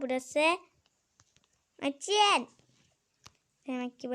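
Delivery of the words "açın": bağırarak. Real, not acted. Kapalı kapıya seslenir.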